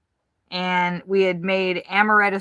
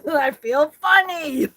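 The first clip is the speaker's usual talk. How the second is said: put-on drunk chicken voice; 9 semitones higher, 5 dB louder, slower than usual. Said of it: "or were they like".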